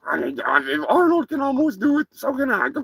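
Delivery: a cocky cartoon turkey boasting; turkey character.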